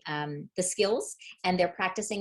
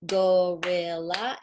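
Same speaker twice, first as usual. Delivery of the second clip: gorilla said slowly and a bit louder as a whole word; slow showing word; 4 dB louder, much slower than usual. Shown as a demonstration. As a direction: hold up the word gorilla and stretch it out. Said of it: "So I would show it".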